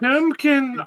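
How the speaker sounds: put-on Chimkin shouted in cutesy baby talk; high playful Chimkin. Echoing the baby-talk word.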